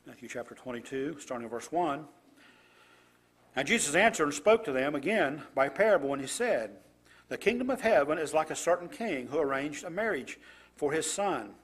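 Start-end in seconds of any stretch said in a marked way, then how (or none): 3.6-11.5 louder, deliberate Bible reading; scripture reading. Acted. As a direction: read the passage out to the congregation with weight. Reads scripture passage aloud.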